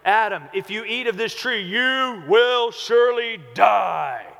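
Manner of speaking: booming, slow, shouted warning; harsh thundering threat. Shown as a demonstration. Preacher mocking a wrong reading, shouting the threat. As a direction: bellow it like an angry judge. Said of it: "don't read it like".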